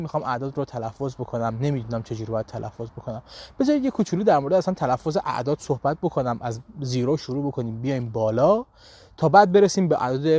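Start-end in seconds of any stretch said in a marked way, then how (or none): none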